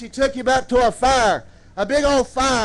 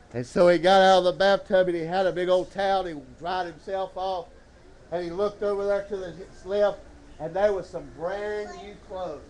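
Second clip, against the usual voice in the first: ordinary talk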